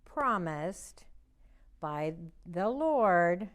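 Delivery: slow, word by word, for signing along; slow signing recital. Shown as a demonstration. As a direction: stretch each word for the signs. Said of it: "do this in sign language".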